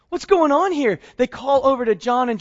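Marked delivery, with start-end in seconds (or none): 0.1-1.0 higher and louder, baffled at the haul; stunned disbelief at a full net; sudden amazed outburst. Acted. Fishermen amazed at the catch.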